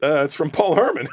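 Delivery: laughing through the retelling, high and loud; can't get it out for giggling; telling the hosts about meeting De Niro, laughing. Real, not acted.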